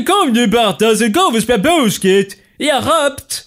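high, hammed-up Scanian accent calling a friend; a proud Lund local, guttural r, teasing; mock Skåne accent. Mock Lund local inviting to basketball. (skämtsam skånska med skorrande r)

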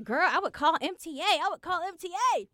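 urgent friend pushing; roommate advice. Retold or imitated. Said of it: "She was like".

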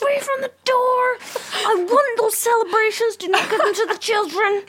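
high shrill mock screaming; hammed-up yelling from the corner. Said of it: "screaming at people as they went past the window".

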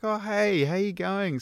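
high, bright quoted woman; lightly voiced friendly mum; chirpy lady voice.

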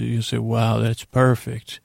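ordinary talk

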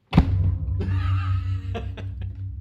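bursting out laughing; big real laugh. Real, not acted. Prank discovered.

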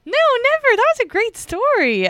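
high, loud sarcastic reassurance; mock sarcastic. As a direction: lay on the fake sincerity. Teasing reply to cut-off joke.

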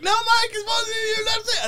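very high strained mock shout; exasperated yell. Crew shouting up to actor on crane, high exasperated yell. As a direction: holler it up to someone far above.

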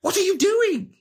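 rising to a high, loud exasperated cry; exasperated outburst. Retold or imitated. Wizard exasperated at companion licking a spider, loud high outburst. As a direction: shriek at him like he's lost his mind. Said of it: "your whole exasperation".

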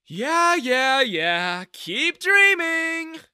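loud, high, drawn-out jeer; dismissive mockery. A narrator's reading. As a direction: brush off the threat with a taunt. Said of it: "He just rolled his eyes".

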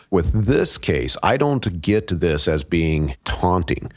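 usual speaking voice

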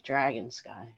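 soft, hushed revelation; awed. Retold or imitated. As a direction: breathe it out in wonder. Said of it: "I just looked up and I'm like".

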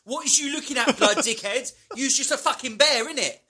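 high, loud mock chav rant; hammed-up street aggression, pitched high; chav trash talk. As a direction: shout abuse like a mouthy chav. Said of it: "trash talked by a chav".

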